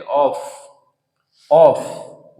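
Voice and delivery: "ऑफ" ending in an f sound; common mispronunciation. Shown as a demonstration. Teacher shows learners the common error, mispronounced "ऑफ" with f. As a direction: say "ऑफ" with a final f. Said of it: "इसे लोग बोलते हैं".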